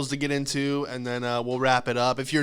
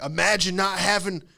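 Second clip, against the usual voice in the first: put-on higher-pitched good old boy retort; mock patriot comeback, pitched up; 6 semitones higher than usual. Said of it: "Some good old boys were like".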